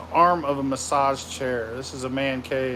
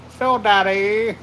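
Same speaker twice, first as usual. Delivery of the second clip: loud, slow sing-song mock call; kids' taunt; 4 semitones higher, 5 dB louder, slower than usual. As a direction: imitate kids calling out a nickname. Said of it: "people always called me Phil Daddy".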